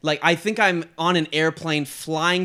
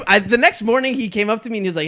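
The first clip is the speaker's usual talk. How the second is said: normal talking voice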